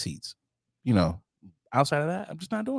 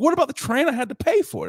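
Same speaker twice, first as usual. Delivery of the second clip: indignant protest; 11 semitones higher than usual. Retold or imitated. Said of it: "I was like".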